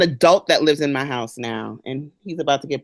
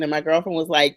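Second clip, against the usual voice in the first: usual speaking voice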